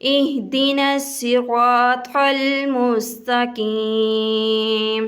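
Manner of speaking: slow, melodic recitation with long held vowels; Quran recitation. Shown as a demonstration. Teacher recites the full Fatiha as a model for students. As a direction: chant the whole sura reverently and slowly. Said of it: "پھر ہم پڑھتے ہیں".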